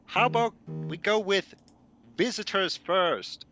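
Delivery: high, loud shout, slow at the end; shouting back. Acted. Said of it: "shouting back".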